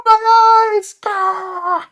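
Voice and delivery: very high, loud wail; comic agonized screech; mock horror scream. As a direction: shriek as if blinded, hammed-up. Mock horror at hot pants image.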